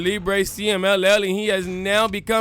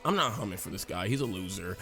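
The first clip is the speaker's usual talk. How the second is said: much lower and softer, dismissive; dismissive sneer; 10 semitones lower, 9 dB quieter than usual. Retold or imitated. Rival wrestler dismissing Humberto, low quiet sneer. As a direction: brush him off coldly.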